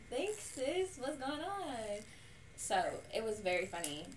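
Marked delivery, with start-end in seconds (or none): 0.1-2.0 confused reply. Retold or imitated. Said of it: "you like".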